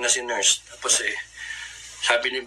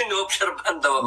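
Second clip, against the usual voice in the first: laughing; 3 semitones higher than usual. Real, not acted. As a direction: burst of laughter into the words. Laughing at his own survival.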